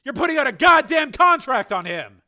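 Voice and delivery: loud, high accusation; angry shout. Acted. Engineer accusing the senator, shouting.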